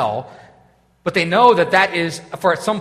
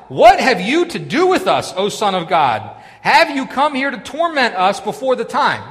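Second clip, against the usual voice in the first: high, loud demon protest; 6 semitones higher, 8 dB louder than usual. Retold or imitated. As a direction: cry out as the demons before Jesus. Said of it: "this is the demon speaking".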